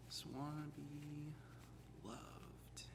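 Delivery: slow fading into a whisper. Pretend. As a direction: breathe the last word out. Joking plea, trailing to whisper.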